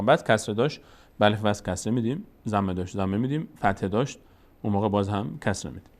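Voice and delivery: usual speaking voice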